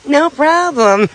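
wry, high and loud sarcasm; sarcastic. Real, not acted. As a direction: joke it off, clearly sarcastic. Sarcastic after 53 miles left.

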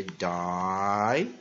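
"die" held long, rising at the end; stressed long vowel. Shown as a demonstration. Teacher marking the stressed first syllable, "die" drawn out long. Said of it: "有重音的地方才会有长母音".

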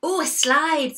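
high, loud exclamation; excited storybook exclamation; delighted discovery. A narrator's reading. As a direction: cry out with glee at the find. Goat delighted at finding a slide.

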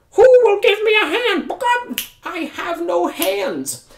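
very high, loud squawking rooster; a high rooster begging for help. Acted. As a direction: crow it out like a fussy rooster. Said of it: "Rooster said".

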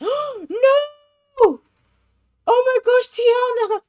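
high, loud gasping wail; aghast outburst. Real, not acted. Player scolding her Sim over flirty ideas, aghast. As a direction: cry out in shocked dismay.

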